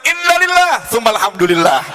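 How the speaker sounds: high, projected exclamation falling at the end; exclaimed condolence-turned-thanks. Retold or imitated. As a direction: cry out INNALILLAH high, then come down. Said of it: "Akhirnya Fulan mengucapkan".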